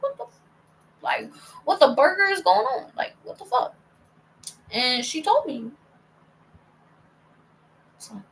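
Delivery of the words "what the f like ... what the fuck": high-pitched, baffled retelling; mock bewilderment. Girl retelling her confusion at mom's news, mock bewilderment. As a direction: act out being baffled, pitch jumping up.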